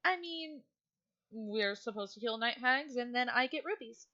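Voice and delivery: a touch high, mumbling through food; mild chewing mumble of a distracted girl; lightly voiced girl mid-bite; Lilith with her mouth full. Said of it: "And Lilith goes".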